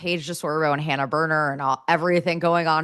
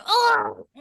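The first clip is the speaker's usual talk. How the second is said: cute coo. Retold or imitated. Cute aggression at nephew.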